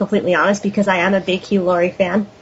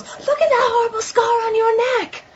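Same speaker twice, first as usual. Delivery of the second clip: imitated scream; 13 semitones higher than usual. Crook blurting at Skinner's door, mock screaming. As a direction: blurt it in a high, held-back imitation yell. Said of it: "when he screams it".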